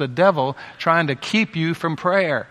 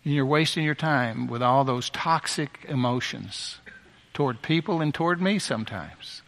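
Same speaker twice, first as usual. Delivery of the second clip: ordinary talk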